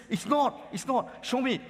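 shocked denial of the wife. Retold or imitated. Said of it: "he was shocked and says".

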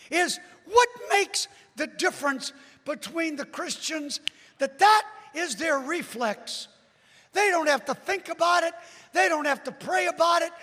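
preacher in full cry; full-force preaching. Real, not acted. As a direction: pile up the points loudly.

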